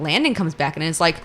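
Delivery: ordinary delivery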